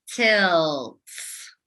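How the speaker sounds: "Tilts" drawn out sound by sound; stretched word. Shown as a demonstration. Teacher stretching "Tilts" for phonics practice. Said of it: "Let's stretch out that word".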